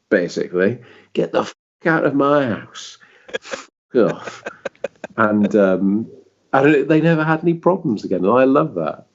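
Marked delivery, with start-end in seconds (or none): 1.1-4.4 raised, higher voice telling it to get out; a mock hard-man Yorkshire dad ordering a ghost out; ghost-evicting dad, half-shouted; angry Yorkshire dad. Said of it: "I'm not gonna do a Yorkshire accent".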